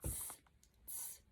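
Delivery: soft whispered monkey tss; monkey hiss. Acted. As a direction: hiss back like a cheeky monkey. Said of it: "stamped both their feet back at him and said".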